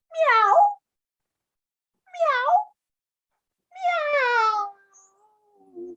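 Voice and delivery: high little kitten mew; tiny, high cat voice; feeble meow. Acted. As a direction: mew helplessly instead of roaring. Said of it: "He'd lost his famous roar".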